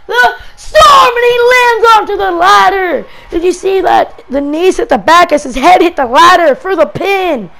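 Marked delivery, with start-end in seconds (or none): 0.1-3.0 yelling the big spot, pitch climbing high; hyped commentator yell; excited shout. Real, not acted. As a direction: scream it like the crowd just erupted.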